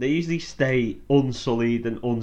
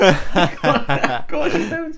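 laughing; 6 semitones higher, 4 dB louder, much slower than usual. Real, not acted. Laughing mock reply.

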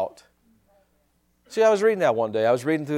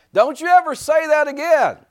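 high, loud scolding yell; mock furious shout at the bird; angry owner; 12 semitones higher, 7 dB louder than usual. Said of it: "smacks the parrot around".

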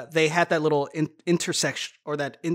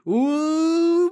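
excited ooh; 11 semitones higher, 5 dB louder than usual. Retold or imitated. Quoting excited text.

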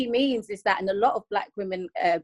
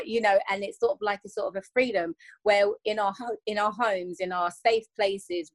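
usual speaking voice

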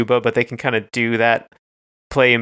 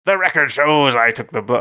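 [name] impression. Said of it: "imitate this voice".

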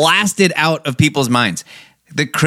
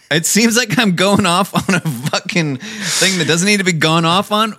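ordinary delivery

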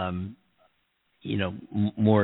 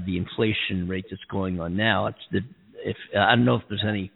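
normal talking voice

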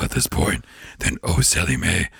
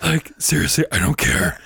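Satan voice; 9 semitones higher than usual. Retold or imitated. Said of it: "that voice is hard to do".

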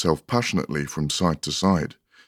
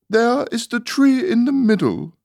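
much higher, louder prim rose; lofty flower; 6 dB louder than usual. Acted. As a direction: answer airily like a grand rose. Rose replies.

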